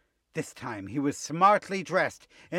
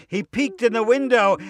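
plain reading voice

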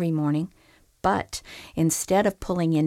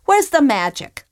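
higher and louder, demanding; indignant demand; 8 semitones higher, 6 dB louder than usual. Acted. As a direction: insist on the magic. Fisherman demands magic.

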